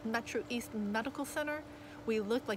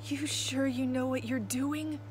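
slow, higher, wheezy doubt; wheezed question; 4 semitones higher, slower than usual. A narrator's reading. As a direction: wheeze it out to the driver. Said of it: "To Cap, I wheezed".

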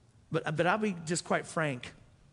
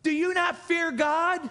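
forceful rebuke; 11 semitones higher, 6 dB louder, slower than usual. Retold or imitated. Thief rebukes fellow criminal.

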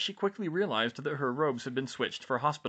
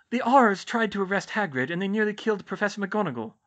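put-on high, louder young witch voice; Hermione's character voice; 9 semitones higher, 6 dB louder than usual. Hermione breaking news of the attack to George, high character voice. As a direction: voice her higher and louder than narration.

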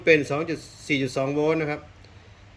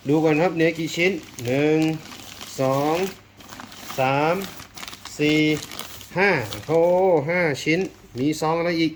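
counting out loud, higher and louder; eager unboxing count, louder and higher; excited counting; 6 semitones higher, 5 dB louder than usual. Real, not acted. Counting the main items excitedly.

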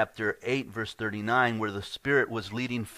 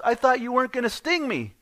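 very high, louder stung cry; betrayed frog; 14 semitones higher, 7 dB louder than usual. Acted. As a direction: frog hurt and shocked mid-river. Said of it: "frog says".

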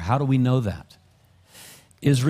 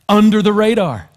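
preaching louder and louder at full force; fervent preaching; 8 semitones higher, 8 dB louder than usual. Acted. Pastor building to the congregation, fervent preaching. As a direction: drive it home to the congregation, rising louder.

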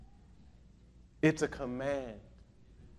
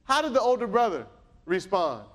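ordinary delivery

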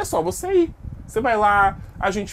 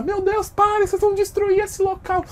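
much higher put-on pleading voice; panicked shop staff. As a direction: beg them to stop in alarm. (funcionário em pânico, voz bem aguda)

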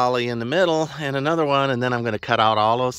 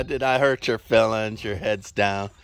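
usual speaking voice

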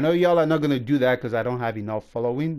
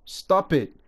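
higher, louder stop it; angry scolding stop it; sharp order; 4 semitones higher, 4 dB louder than usual. Real, not acted. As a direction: snap the command at them. Scolding players in a rant.

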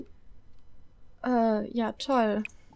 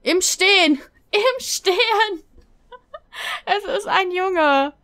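high, loud, laughing astonishment; giddy high-pitched disbelief; amazed hilarity; 6 semitones higher, 5 dB louder, slower than usual. Real, not acted. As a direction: squeal it through laughter. Amazed at birth while standing.

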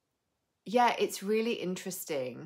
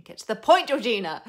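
mock heckle; 6 semitones higher, 12 dB louder than usual.

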